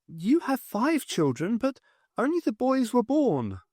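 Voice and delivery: put-on a dead girl stating the truth; eerie calm child voice; calm ghost girl. A narrator's reading. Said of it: "James said calmly".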